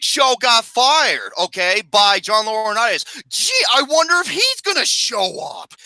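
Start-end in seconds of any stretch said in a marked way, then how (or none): 3.3-5.7 dry mock wonder, pitched up; heavy sarcasm about the obvious; sarcastic. Real, not acted. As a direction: feign surprise you don't feel. Sarcastic aside about Big Show.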